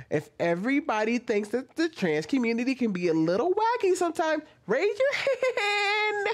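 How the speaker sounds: mock a perky kids' channel host; childish YouTuber voice. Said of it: "I never talk like a child".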